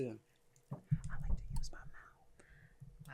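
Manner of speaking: slow embarrassed whisper. Real, not acted. Bashful admission about oral sex.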